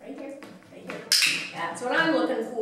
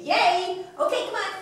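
excited cheering; 10 semitones higher, 4 dB louder than usual. Real, not acted. Cheering the dog.

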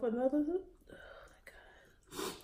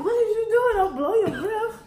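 laughing; 8 semitones higher, 3 dB louder than usual. Real, not acted. Laughing at burning friend.

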